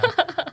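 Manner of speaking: quick laugh, high pitch; short embarrassed laugh. Real, not acted. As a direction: burst out laughing briefly. Guest laughs at surprise.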